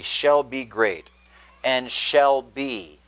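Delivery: slow, with shall leaned on each time; stress on shall. Shown as a demonstration. Preacher hammering home that Jesus shall be great, slow stress on shall. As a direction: press every shall, unhurried. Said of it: "I emphasize the word shall be".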